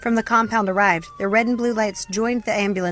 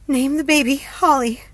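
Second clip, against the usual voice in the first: higher, louder, slow pleading; pained plea; 7 semitones higher, 5 dB louder than usual. A narrator's reading. Hope in labour names the baby, pained plea. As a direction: push the words out through pain. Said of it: "let out a yell".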